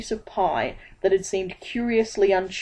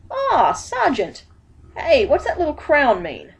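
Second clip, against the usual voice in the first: high, eager street-hawker voice; bright-eyed salesman, pitched high; Dibbler the pieman; 8 semitones higher, 3 dB louder than usual. A narrator's reading. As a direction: pitch it up like a pushy pie seller. Dibbler voiced in read story.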